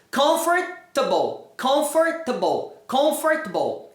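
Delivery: slow, drawn-out "comfortable" stressed on COM; syllable-by-syllable "comfortable" with reduced -able; correct pronunciation. Shown as a demonstration. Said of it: "nunca vai ser a sílaba tônica".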